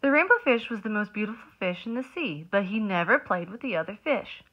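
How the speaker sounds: lively storybook narration; reading to children. A narrator's reading. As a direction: read it like circle time. Reads picture book aloud.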